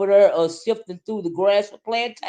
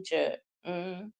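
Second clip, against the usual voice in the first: ordinary delivery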